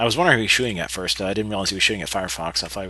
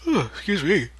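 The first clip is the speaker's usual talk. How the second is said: yawning; 9 semitones higher than usual. Real, not acted. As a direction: excuse me through a yawn. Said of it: "I'm yawning".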